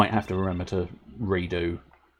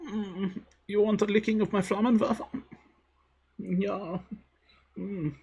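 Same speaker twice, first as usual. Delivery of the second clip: put-on high, saucy German accent; camp German accent; 11 semitones higher than usual. Streamer flirts about the flamethrower, super camp German accent. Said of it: "My super camp German accent".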